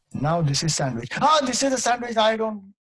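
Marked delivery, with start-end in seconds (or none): 1.2-2.7 high-pitched startled outcry; mock panic. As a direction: act terrified at the sight of it. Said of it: "He's got so psyched".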